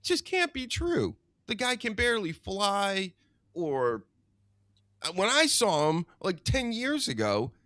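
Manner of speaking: high, disbelieving rant; can't believe it, voice climbing; incredulous. Real, not acted. Talking about an old wrestler returning, high disbelief.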